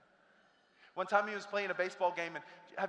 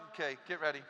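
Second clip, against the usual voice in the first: ordinary talk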